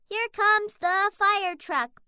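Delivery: plain reading voice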